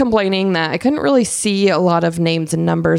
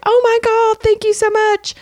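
very high, gushing mock thanks; squealing "oh my God" thank-you. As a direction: overplay delighted gratitude. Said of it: "she was like".

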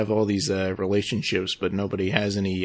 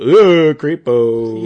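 grossed out; 9 dB louder, much slower than usual. Retold or imitated. Mocking [name]'s reaction.

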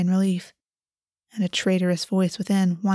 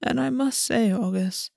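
Raven Prince. A narrator's reading. Raven Prince's long speech.

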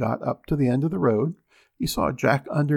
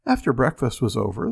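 usual speaking voice

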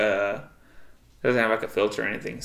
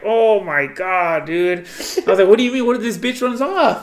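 high, loud retold panic; stressed out; 7 semitones higher, 6 dB louder than usual. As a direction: exaggerate the stress, getting higher as it goes. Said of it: "I stressed out, like, five years".

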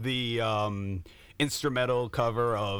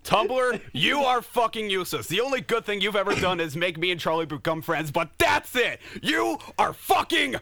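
high-pitched shouted tirade; mock angry rant; 7 semitones higher than usual. Comic angry rant at Tumblr.

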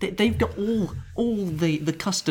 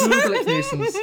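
laughing; 3 dB louder than usual. Real, not acted. Laughs at the match.

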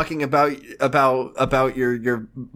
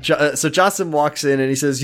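ordinary talk

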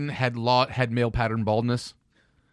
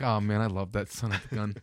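lower, softer fond voice; affectionate; 6 semitones lower, 6 dB quieter than usual. Retold or imitated. Voicing owner's fondness.

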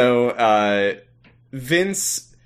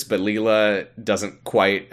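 usual speaking voice

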